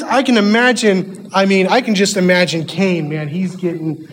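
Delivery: ordinary delivery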